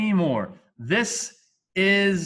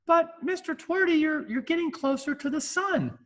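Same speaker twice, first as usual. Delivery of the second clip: much higher, mock-eager student question; 13 semitones higher than usual. Teacher voicing a student objecting, high and eager. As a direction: pipe up like a clever kid in class.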